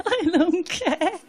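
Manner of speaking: slow, high shrug of a line; dismissive. Retold or imitated. Tipsy drinker dismissing food pairings, slow and dismissive. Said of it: "And you think".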